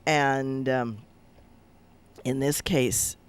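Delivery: ordinary delivery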